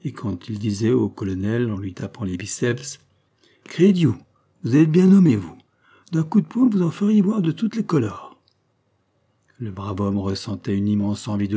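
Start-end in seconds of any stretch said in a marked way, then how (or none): 3.6-8.4 put-on lightly Italian-accented clown, high and loud; Ménilmontant French with a touch of Italian accent. A narrator's reading. Clown ribbing the colonel while tapping his biceps, Italian accent. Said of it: "assaisonné d'un accent italien".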